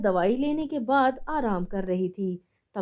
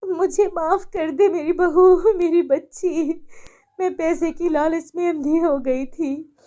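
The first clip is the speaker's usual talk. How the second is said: remorseful pleading; 6 semitones higher, 6 dB louder than usual. Acted. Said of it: "आंखें भर आती हैं".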